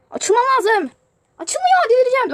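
rising, very high loud frustration; losing patience with the tablet; exasperated shout. Real, not acted. Game keeps failing. (çok yüksek, çileden çıkmış bağırış)

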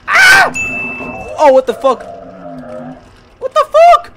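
very high, loud scream of fright; terrified scream. Real, not acted. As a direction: scream out in real terror. Jump scare in horror game.

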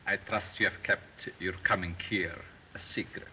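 ordinary talk, nothing marked

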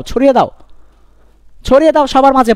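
rousing call. Acted. Rallying students to spread knowledge.

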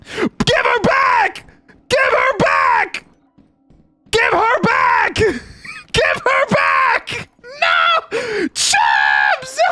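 drawn-out, high screamed plea; desperate screaming. Real, not acted. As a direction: scream it long and high for a snatched teammate. Screams as teammate is taken away.

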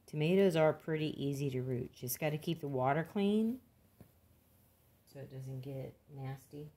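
ordinary talk

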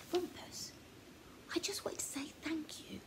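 put-on hushed grateful boy's whisper; soft thanks. A narrator's reading. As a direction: say it gently, almost whispered, to a sleepy friend. Boy thanks at bedtime.

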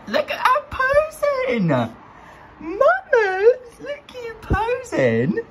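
very high, slow cooing. Real, not acted. Cooing at her posing fish in the tank.

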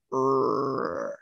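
low growl; dog growl. Acted. Narrator growls for Trey at the bat-winged fossil. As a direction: growl like a wary little dog. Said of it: "Trey growled".

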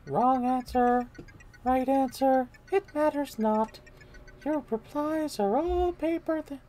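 high, very slow and drawn out; eerie princess voice. Acted. Brainwashed Peach questions Mario, high and eerie. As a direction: float it up high, creepy and slow.

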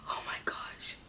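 gasp, then whisper; overwhelmed fangirl. Pretend. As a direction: whisper as if starstruck speechless. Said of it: "pretending like I'm some crazy fangirl".

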